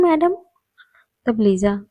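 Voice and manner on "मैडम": teasing. Acted. June teasing at the door.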